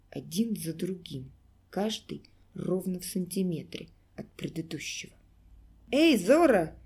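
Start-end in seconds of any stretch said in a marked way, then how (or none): 5.9-6.8 loud call from above; shouting. Acted. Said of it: "крикнул ему сверху гимнаст".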